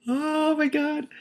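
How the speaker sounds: very high, slow squeal; stunned. Real, not acted. Reader shocked by the story, high drawn-out squeal. Reader reacting to father line.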